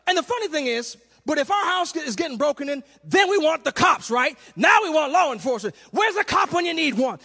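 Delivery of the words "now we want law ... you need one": ordinary talk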